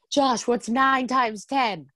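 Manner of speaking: slow, high taunting call; mock taunting yell. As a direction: tease him in a slow sing-song. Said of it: "he kept yelling to him".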